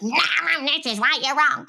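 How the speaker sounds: hammed-up argumentative comeback; lashing out. Podcast host imitating their own urge to lash out, snippy. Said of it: "lash out and be like".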